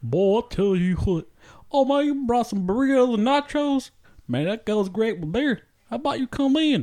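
a booming Texas host; Texan accent. Acted. Actor welcomes his guest in a hammed-up Texan accent. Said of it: "that's a Mexican? From Texas".

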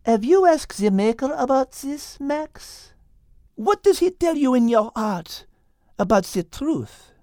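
high, gentle French cat voice; gentle French-accented cat; Liz, French accent. Acted. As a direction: ask him tenderly as Liz. Liz's voice.

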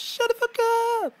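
high, drawn-out yelling rapper. Retold or imitated. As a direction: bellow it like a rapper telling them off. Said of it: "my [name] angel pops up on my shoulder and he goes".

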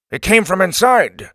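loud, high alert voice; loud guard. Acted. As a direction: call it out to the others. Second guard answers outside.

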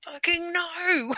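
high, slow, fed-up snap; retold frantic phone reply; exasperated swearing. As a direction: spit it out at the end of your rope. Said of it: "I'm like".

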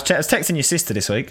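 normal talking voice

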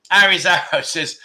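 normal talking voice

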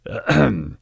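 pompous throat clear. Acted. Knight about to question the master, throat clearing. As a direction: clear the throat before speaking. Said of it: "cleaned his throat".

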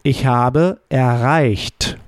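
slow, careful German demo; slow model answer. Shown as a demonstration. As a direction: spell it out slowly for the learner. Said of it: "How would you say in the past".

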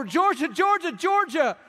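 high, carrying call across a crowd; desperate high call for a lost child; calling out a name. Retold or imitated. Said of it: "we're screaming her name over and over".

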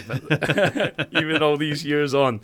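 laughing. Real, not acted. Laughs over old grudge.

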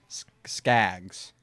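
Skaggs hesitant, then eggs vowel; careful pronunciation. Shown as a demonstration. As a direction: try Skaggs slowly like eggs. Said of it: "That's it".

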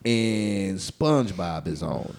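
ordinary delivery

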